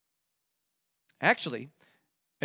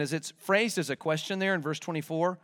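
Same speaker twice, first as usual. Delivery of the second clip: usual speaking voice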